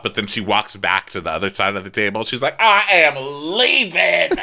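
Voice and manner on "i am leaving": loud, high announcement of outrage; hammed-up indignant yell; mock screaming. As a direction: imitate a dramatic storm-out. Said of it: "keeps screaming".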